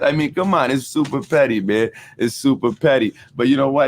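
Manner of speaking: ordinary delivery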